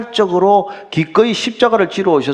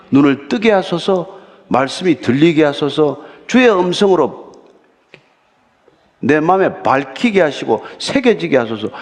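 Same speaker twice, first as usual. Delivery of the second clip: prayer; 4 semitones lower than usual. Shown as a demonstration. Sample prayer before reading.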